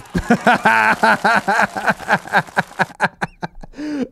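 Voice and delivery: long, high, loud laughing fit; real, sustained high laugh; helpless laughter. Real, not acted. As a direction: crack up at the absurd sight. Cracks up at North Korean soldiers.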